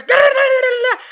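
high, loud nonsense yelling; imitation of screamed Spanish babble; mock Spanish screaming. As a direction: rattle off loud gibberish like an angry mom. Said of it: "starts screaming in Spanish".

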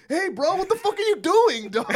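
high, loud disbelief; mock exasperated buddy. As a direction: scold a drunk friend in mock alarm. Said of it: "your best friend who's always drunk".

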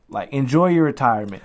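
normal talking voice

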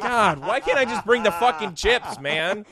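high, loud exasperated complaint; mock exasperated whine. Real, not acted. Mock protest about funeral duty.

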